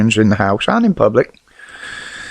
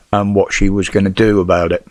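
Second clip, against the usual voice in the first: ordinary talk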